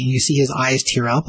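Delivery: ordinary delivery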